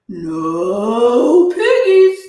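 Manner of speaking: very slow, drawn-out cow reply. A narrator's reading. Cow answering the witch, slow and lazy. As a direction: moo it out, lazy.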